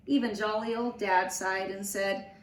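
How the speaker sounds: usual speaking voice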